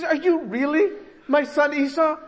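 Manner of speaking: high-pitched, hammed-up incredulous question; incredulous Isaac. Isaac questioning his disguised son, mock incredulous. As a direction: ask it high, in exaggerated disbelief.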